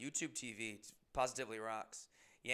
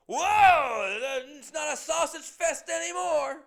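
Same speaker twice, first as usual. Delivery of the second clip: high, loud, slow mock yell at the new guy; loud drunk regular; 14 dB louder, slower than usual. Dopey drunk barfly hollering at newcomer, high loud mock yell. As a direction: ham up a dopey barfly hollering across the bar.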